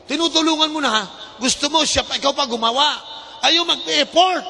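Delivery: high-pitched mock indignation; exasperated scolding. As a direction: complain in high exasperation, stretching the end. Exasperated at those refusing help. (naiinis na panenermon, mataas ang boses)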